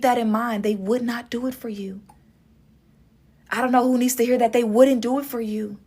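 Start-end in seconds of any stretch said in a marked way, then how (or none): 0.6-2.0 hushed, voiceless warning; whisper. Real, not acted. Whispered warning to viewers about a selfish ex. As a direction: breathe it like a secret.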